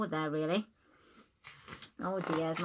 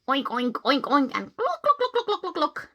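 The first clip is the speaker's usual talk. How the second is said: louder oinks then high clucks; animal noises; 5 semitones higher, 5 dB louder than usual. Acted. Pig chasing the hen, oinking then high clucking.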